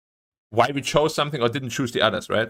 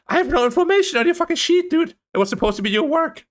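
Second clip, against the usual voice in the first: much higher, sharp indignant outburst at teammates; snapping at the teammates who left him blank; furious retort; 13 semitones higher than usual. Retold or imitated. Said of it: "And I'm like".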